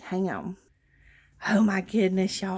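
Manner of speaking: ordinary talk, nothing marked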